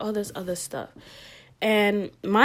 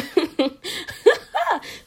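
laugh; 9 semitones higher than usual. Real, not acted. Laughs at her story.

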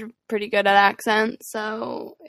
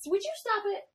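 scolding the cat; 12 semitones higher, 10 dB quieter than usual. Real, not acted.

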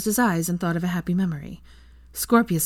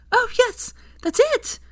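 much higher, louder delight; excited; 4 dB louder than usual. A narrator's reading. Hermione seeing stronger wisps, excited much higher. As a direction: squeal with delight.

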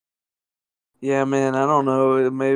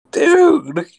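high shouted dude; excited shout; 5 dB louder than usual. Real, not acted. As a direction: yell it out in excitement.

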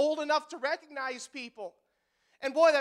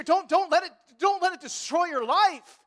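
pleading preacher; 6 semitones higher, 5 dB louder than usual. Real, not acted.

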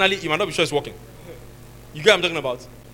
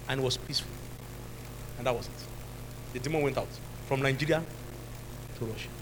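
usual speaking voice

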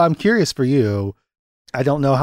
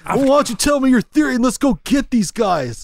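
high, hammed-up rookie cop; rally your partner, voice pitched way up; Keanu Reeves; 10 semitones higher than usual. Urging the partner to go, high Keanu impression. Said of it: "You do the Keanu lines".

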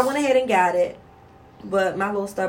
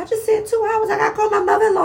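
high frantic panic; panicked; 13 semitones higher, 4 dB louder, faster than usual. Retold or imitated. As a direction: squeal it in a high frantic rush. Panicked mom retelling labor.

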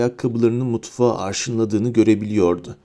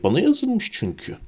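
high indignant retort; angry; 10 semitones higher than usual. A narrator's reading. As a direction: argue crossly in a high pitch. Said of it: "dedi öfkeyle".

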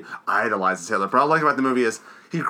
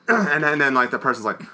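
ordinary talk, nothing marked

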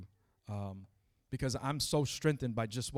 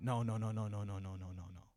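nagging inner dissuasion. Acted. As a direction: run of no's sinking away. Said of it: "my flesh is like".

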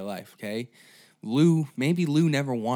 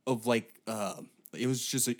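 ordinary talk

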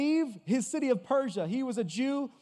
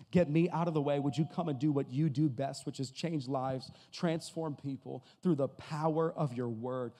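hushed pastoral prayer; 7 semitones lower than usual. Real, not acted.

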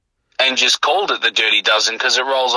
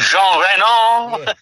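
put-on French accent; 6 semitones higher, much slower than usual. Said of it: "with a French accent".

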